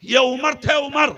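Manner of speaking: loud, impassioned sermon delivery; fiery preaching. Acted. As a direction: preach it to the whole gathering. Preacher at full force.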